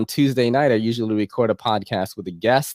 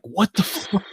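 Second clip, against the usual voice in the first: shocked disbelief; 9 semitones higher, 4 dB quieter, slower than usual. Retold or imitated. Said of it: "I'm like".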